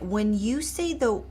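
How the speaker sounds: ordinary talk, nothing marked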